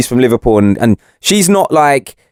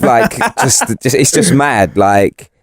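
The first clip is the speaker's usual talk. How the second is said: ordinary talk, nothing marked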